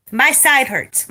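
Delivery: ordinary delivery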